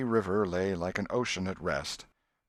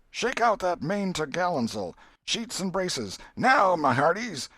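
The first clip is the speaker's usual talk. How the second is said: much higher and louder, commanding the crew; bellowed captain's orders; 11 semitones higher, 6 dB louder than usual. A narrator's reading. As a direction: bark orders across the deck like a ship's captain. Tom commands the raft crew.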